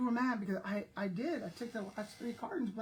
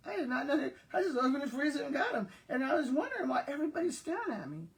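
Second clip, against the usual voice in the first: talking through real laughter, higher; laughing; 5 semitones higher, faster than usual. Real, not acted. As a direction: laugh while telling it. Laughing at own ignorance.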